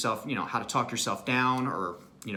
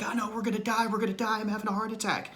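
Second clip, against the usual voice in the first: high, frantic mock panic; hammed-up terrified wail; panicked freaking out; 11 semitones higher than usual. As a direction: play someone sure they're dying. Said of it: "you can't be like".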